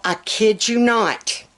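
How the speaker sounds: usual speaking voice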